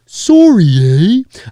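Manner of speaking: loud, high, drawn-out Canadian "sorry, eh"; mock Canadian, hammed right up; exaggerated Canadian accent. Shown as a demonstration. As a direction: stretch out a cartoon Canadian SORE-ee, eh. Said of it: "that was very Canadian".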